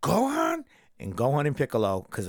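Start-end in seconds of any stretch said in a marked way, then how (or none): 0.0-0.6 tearful call. Retold or imitated. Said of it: "he's like".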